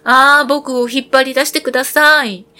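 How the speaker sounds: urgent little bread voice calling for help; crying out. Acted. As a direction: beg to be pulled out before burning. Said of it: "パンが叫びました".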